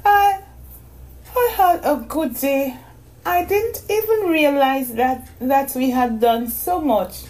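put-on high, sleepy girl voice; a tired little girl asking sweetly; young girl voice. A narrator's reading.

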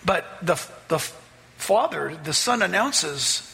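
ordinary talk

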